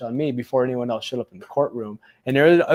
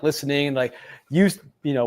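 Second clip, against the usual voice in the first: ordinary delivery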